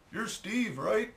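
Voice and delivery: high-pitched mock yell; imitated calling back. Dog's owner checking who Steve is, high mock yell. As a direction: imitate a neighbour calling over, voice way up. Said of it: "he yells back".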